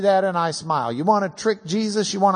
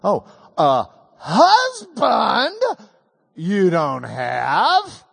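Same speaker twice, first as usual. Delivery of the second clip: slow drawn-out "Oh, a husband" then rising "you don't have?"; Jesus turning her words back on her, slow and knowing; ironic stress on husband; 2 semitones higher, 3 dB louder, much slower than usual. Retold or imitated. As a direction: stretch it out with gentle irony, stressing "husband". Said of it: "Jesus says".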